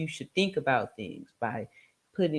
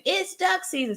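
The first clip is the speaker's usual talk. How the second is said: mock Daffy Duck; 12 semitones higher than usual. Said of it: "Daffy turns it around and says".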